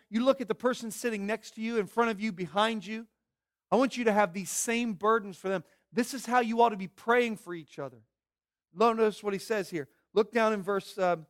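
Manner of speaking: normal talking voice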